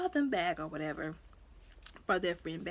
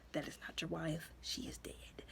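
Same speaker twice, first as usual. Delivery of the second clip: whispered grim truth; 3 semitones lower, 14 dB quieter than usual. Retold or imitated. Siblings telling father mother is dead.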